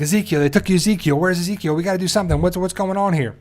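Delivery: lightly imitating someone frantic; freaking out. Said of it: "And they're freaking out".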